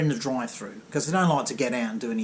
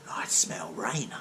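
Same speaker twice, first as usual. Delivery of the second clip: whispered; 8 semitones lower, 7 dB quieter, much slower than usual. Retold or imitated. Tradie repeat whispered.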